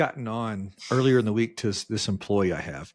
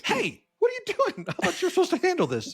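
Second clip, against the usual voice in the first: very high, squeaky mock outrage; comic high-pitched indignation; exasperated protest; faster than usual. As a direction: retell your disbelief in a strained high pitch. Retold protest to HR.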